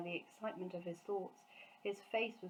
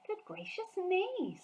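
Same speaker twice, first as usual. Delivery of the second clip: put-on high, slow little-girl gasp of wonder; amazed girl; 13 semitones higher, slower than usual. A narrator's reading. Sophie amazed by the giant's hearing, high slow wonder. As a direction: stretch it out in awe.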